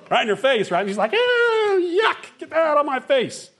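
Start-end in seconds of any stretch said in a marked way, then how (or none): 1.1-3.5 high, squealing mock disgust from the wife. Wife recoiling from his breath.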